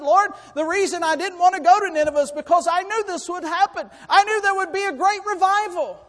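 very high, loud preached protest; indignant outburst. Acted. Prophet explaining to the Lord why he fled, indignant outburst. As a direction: cry out the grievance at full force. Acts out Jonah's protest to God.

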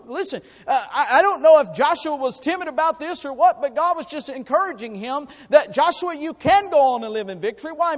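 loud, high, rising preacher's cry; preaching at full force. Acted. Preacher urging the church to courage, full force. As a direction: drive the point home to the congregation.

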